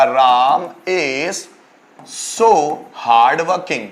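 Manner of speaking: very slow, each word spaced; slow dictation. Shown as a demonstration. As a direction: read it out slowly for students to write. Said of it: "teacher dictates example sentence".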